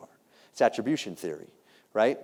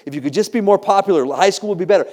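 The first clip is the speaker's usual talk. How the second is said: loud, higher voice of pushy advice; insistent, raised-pitch mimicry; mock urging; 7 semitones higher, 7 dB louder than usual. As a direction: push the false promise hard. Mimicking what adults tell teenagers.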